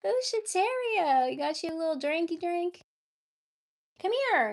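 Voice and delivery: sweet high call to a pet; high cooing pet talk. Real, not acted. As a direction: coo at a shy kitty to come out. Greeting the cat with a treat.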